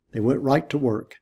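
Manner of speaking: plain reading voice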